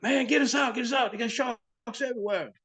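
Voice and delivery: high-pitched, frantic shout for rescue; urgent plea. Retold or imitated. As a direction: call up to the boat in a reenacted panic. Retelling divers pleading to be pulled out.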